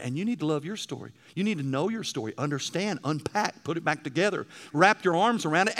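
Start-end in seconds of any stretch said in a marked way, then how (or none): 4.7-5.8 raised, ringing pulpit voice, pitch up; full-force preaching. Acted. Preacher urging the congregation to tell their story, full-force preaching. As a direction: drive it home to the pews like a revival preacher. Sermon climax on telling story.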